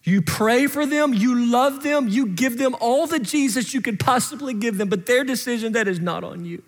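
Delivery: high, urgent pulpit delivery; impassioned preaching. Real, not acted. Preacher releasing parents from blame, impassioned. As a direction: preach it with rising fervor in a high pitch.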